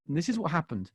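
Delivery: ordinary talk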